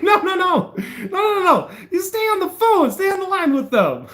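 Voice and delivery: retold frantic plea to the dispatcher; exasperated insistence. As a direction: plead them to stay on the line. Insisting on the phone.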